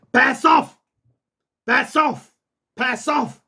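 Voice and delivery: high, firm order to a guard dog; drill sergeant command. Shown as a demonstration. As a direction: send the dog to guard with authority. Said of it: "like you a drill sergeant".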